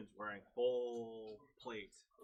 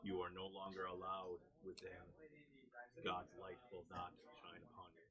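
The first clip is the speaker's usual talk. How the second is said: softer, measured hint of a stern guard; stern deep voice; 2 semitones lower, 7 dB quieter, faster than usual. Guard repeating the ban at the door, quietly stern. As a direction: deliver a quiet, solemn pronouncement. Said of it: "in a deep, deep voice".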